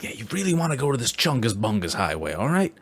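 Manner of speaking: New York Italian stranger pitching the secret highway to tourists; New York Italian accent. Retold or imitated. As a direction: sell it like a New York Italian con man. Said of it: "He's like".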